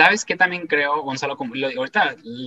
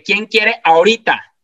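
raised, higher and slow, projected to the hall; loud call to the crowd; 6 semitones higher, 8 dB louder, slower than usual. Retold or imitated. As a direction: belt the question out to the audience. Calling to crowd on stage.

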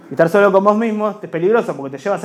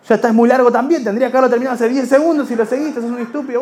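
louder, higher exasperated outburst; higher, louder annoyed inner voice; angry rant; 6 semitones higher, 5 dB louder than usual. Acted. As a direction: snap at him in rising anger. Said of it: "inner voices quarrel".

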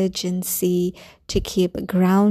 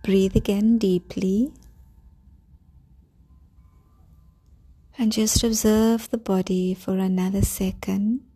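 calm grounding exercise. Acted. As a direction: guide them gently into calm. Leads a grounding meditation.

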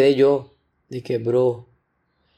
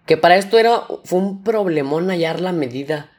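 normal talking voice

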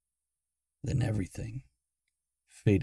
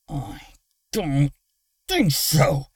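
growl through teeth; 5 semitones higher, 5 dB louder, slower than usual. Acted. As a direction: force it out through clenched teeth. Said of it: "Luna growled through gritted teeth".

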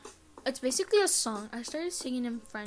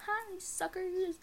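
taunting; 8 semitones higher, 5 dB quieter, much slower than usual. Retold or imitated. Taunting crying friends.

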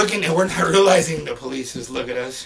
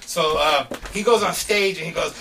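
ordinary talk, nothing marked

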